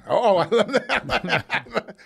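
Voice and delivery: slow, high, laughing delight. Real, not acted. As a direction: savor it through a laugh. Laughs at the guest's joke.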